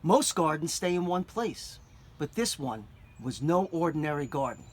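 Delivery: ordinary delivery, nothing marked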